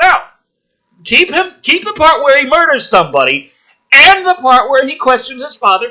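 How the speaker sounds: loud, high, exasperated yelling at the script; furious rant. Real, not acted. Comedian ranting at the screenplay, loud exasperated yell. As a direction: blow up at how dumb the writing is.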